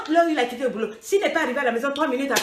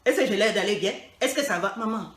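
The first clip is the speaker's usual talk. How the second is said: sulky retort; 5 semitones lower than usual. Retold or imitated. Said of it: "Caraba la sorcière".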